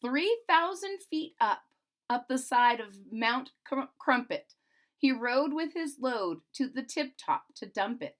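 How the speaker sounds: put-on rhythmic read-aloud to children; storybook narration. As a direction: lilt the rhyming verse. Reads the Grinch book aloud.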